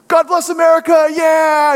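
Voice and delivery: high, loud slogan into a long cheer; mock rallying cry; politician's rally shout. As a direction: rescue an awkward speech with a big shout. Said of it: "and then he's like".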